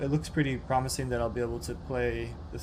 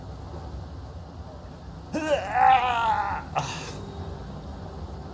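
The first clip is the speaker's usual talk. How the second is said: long exasperated groan of defeat; frustrated groan; 11 semitones higher, 4 dB louder than usual. Real, not acted. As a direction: groan it out, fed up. Failed jump, gives up.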